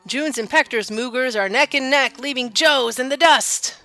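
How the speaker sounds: loud, high contest announcer call; booming prize announcer. Acted. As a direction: call the race like a rodeo announcer. Reads contest announcer lines.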